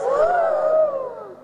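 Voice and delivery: sharp high gasp; shocked gasp. Acted. Dorothy hears she must kill the witch, gasping. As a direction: gasp in alarm.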